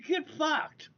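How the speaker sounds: scornful dismissal; dismissive. Real, not acted. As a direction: spit it out dismissively.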